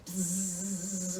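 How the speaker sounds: low, soft buzzing hum; mosquito buzz. A narrator's reading. Mosquito buzzing over the elephant, soft buzz.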